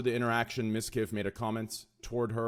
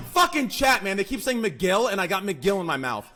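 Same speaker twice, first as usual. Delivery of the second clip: much higher and louder, laughing frustration at chat; exasperated outburst; 9 semitones higher, 10 dB louder than usual. Real, not acted. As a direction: blow up at the chat for the name mix-up. Chat keeps misnaming him, exasperated.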